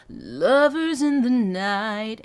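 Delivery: slow sung, anchored down on lovers; ground down on lovers. Shown as a demonstration. Coach showing anchor on lovers. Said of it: "anchoring down into that".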